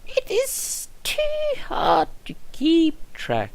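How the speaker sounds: put-on high old nurse voice, slow; high old nurse, tired; first take. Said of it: "No, sorry, I said it wrong. Let's try again".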